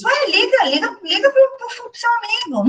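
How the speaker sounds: high, insistent mom voice; mom urging her kid. Retold or imitated. Mother nagging her son to call a friend, high and insistent. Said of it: "eu falo assim pros meus filhos".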